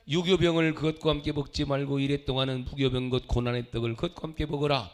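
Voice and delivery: loud, low, measured congregational reading; scripture reading aloud. Real, not acted. Preacher leading the church through Deuteronomy, solemn loud reading. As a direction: lead the congregation in reciting the verse.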